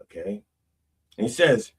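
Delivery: usual speaking voice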